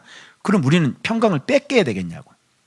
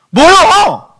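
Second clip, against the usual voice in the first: high, loud challenging shout; mock yell; 9 dB louder, much slower than usual. Preacher acts out two deacons shouting at each other, loud mock yell. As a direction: bark back like starting a fight. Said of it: "이렇게 막 소리 지르고".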